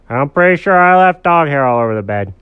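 emphatic lightly voiced dog; dog character voice. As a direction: own up as a cartoon dog. Dog admits shedding.

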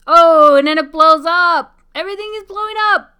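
loud, high excited shout. Real, not acted. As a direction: yell it as everything explodes. Ship explodes on screen.

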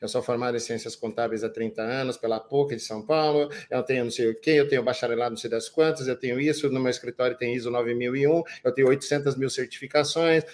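low, dull self-introduction drone; mock a tedious accountant reciting his CV; lightly voiced dull presenter listing credentials; boring speaker. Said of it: "que cara chato da porra".

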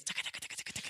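rapid whispered taka-taka; soft, voiceless clatter of running feet; imitated running footsteps. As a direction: patter out footsteps under the breath. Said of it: "running like idiots".

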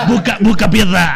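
loud pushy stall hawker, laughing; singsong market price calls. Retold or imitated. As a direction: call out to shoppers like a market vendor. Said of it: "Meave market stall sellers". (pregón de vendedor de plaza Meave, cantadito y fuerte)

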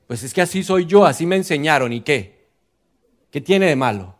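hammed-up defiant costeño. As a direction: defend yourself, stubborn and proud. Man defends his coarse speech.

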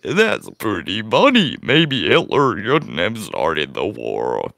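slow, low drawn-out put-on voice; a deep, dragging caricature of a streamer; Brutus impression. Host fumbling an impression of a streamer, deep and drawn-out. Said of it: "figure this out in an English accent".

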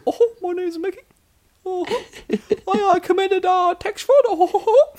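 very high cartoon falsetto; Mickey Mouse. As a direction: squeak it out as a cartoon mouse, near laughter. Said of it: "British or Mickey Mouse".

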